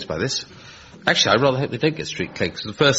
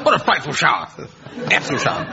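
Terry-Thomas impression; 5 semitones higher, faster than usual. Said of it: "Terry Thomas films".

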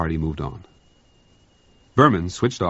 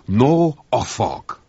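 French cook; 5 semitones higher, 4 dB louder, much slower than usual. Acted. Cook flatly repeats, slow.

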